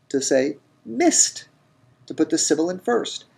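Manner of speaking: normal talking voice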